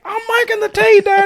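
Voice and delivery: put-on very high, loud, panicked teen squeal; teen Rome being chased down the hallway, squealing. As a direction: squeal it while running away. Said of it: "spot-on impression of Rome".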